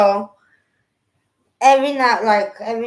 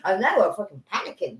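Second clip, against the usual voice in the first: ordinary talk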